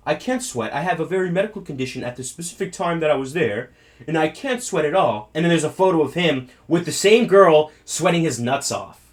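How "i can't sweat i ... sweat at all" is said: mocking British accent, softer; a posh-ish British Prince Andrew parody; lightly done British accent. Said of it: "I'm gonna do a British accent".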